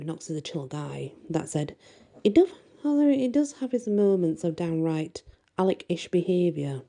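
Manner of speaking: usual speaking voice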